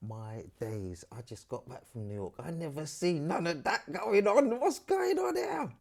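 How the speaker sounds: starts hushed and slow, then rises to a high astonished cry; from stunned murmur to a squeaky disbelieving shout; shocked disbelief. Retold or imitated. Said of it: "I was like".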